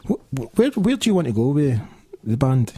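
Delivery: usual speaking voice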